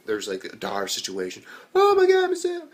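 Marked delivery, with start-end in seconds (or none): 1.8-2.7 loud, very high-pitched outcry; mock alarmed cry. Crying out for Miss Sewell in a dire situation, mock panic.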